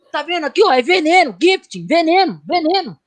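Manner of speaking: high-pitched, insistent mock alarm; mock alarmed explanation. German friend explaining the label to the others, high mock alarm. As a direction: point at the word and cry "poison" up high.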